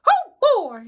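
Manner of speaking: high, loud cartoon mouse squeal; very high falsetto cartoon voice; Mickey Mouse. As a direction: squeak it out, big and cheery. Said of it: "I'm now Mickey Mouse voice actor".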